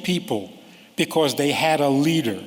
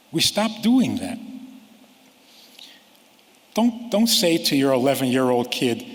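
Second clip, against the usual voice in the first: normal talking voice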